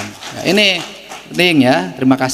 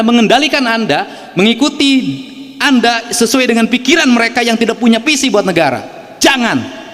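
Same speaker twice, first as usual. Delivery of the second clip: high fiery exhortation; 7 semitones higher than usual. Real, not acted. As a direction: rouse the room to fight back. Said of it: "ngasih intonasinya tinggi itu".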